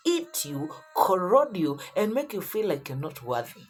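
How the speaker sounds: ordinary delivery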